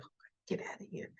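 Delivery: fast breathy whisper. Retold or imitated. Ashley shooing Tucker away at the door, whispered. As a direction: brush him off under your breath.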